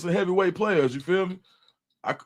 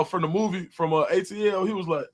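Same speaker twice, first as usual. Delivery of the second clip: ordinary delivery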